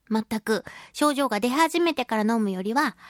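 ordinary talk

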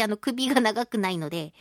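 ordinary talk, nothing marked

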